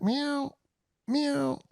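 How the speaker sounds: high, soft imitated meow; cat call meow. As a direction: meow softly to coax a cat back. Calling the cat back.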